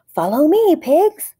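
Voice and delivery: high, loud brave leader voice; heroic leader call. A narrator's reading. Fluffy leading pigs out of cave, high heroic call. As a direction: lead the pigs like a bold captain. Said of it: "said Fluffy the Explorer".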